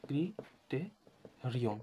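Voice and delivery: ক্রিটেরিয়ন very slowly, with gaps between syllables. Shown as a demonstration. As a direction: dictate ক্রিটেরিয়ন in separate pieces. Sounds out plural while writing it. (ক্রিটেরিয়ন ভেঙে ভেঙে, খুব ধীরে)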